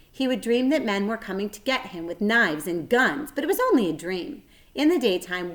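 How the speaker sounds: ordinary delivery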